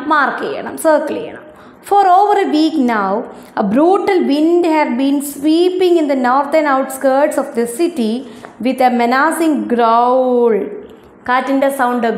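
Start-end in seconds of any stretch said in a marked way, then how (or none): 1.9-10.6 slow, careful textbook reading; reading aloud. Acted. Teacher reading the excerpt to her ninth-graders, slow and careful. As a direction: spell each English word out slowly for the class.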